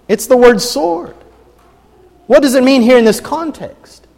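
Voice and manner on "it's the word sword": normal talking voice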